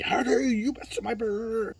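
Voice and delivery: mock indignant squawk; angry guest yell. Retelling the guest who yelled at her, mock outrage. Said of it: "only to have him yell at me".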